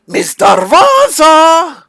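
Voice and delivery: high, slow, loud call; yelling a name. Acted. Toymaker yells for his assistant, loud and high. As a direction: bellow across the workshop. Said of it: "He literally yells".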